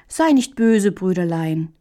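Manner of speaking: plain reading voice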